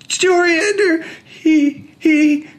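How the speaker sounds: put-on high, loud stammering child; breathless crying boy; sobbing child. A narrator's reading. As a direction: gasp out his name through tears.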